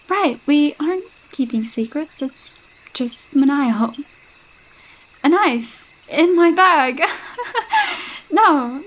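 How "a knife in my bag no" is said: slow words breaking into a high laugh; nervous laugh. Acted. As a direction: laugh off the knife, too brightly. Caught with a knife, laughs.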